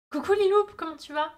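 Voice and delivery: bright, loud, high hello; cheerful greeting. Real, not acted. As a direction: call out a happy hello. Greeting a viewer in chat. (salut joyeux et enjoué)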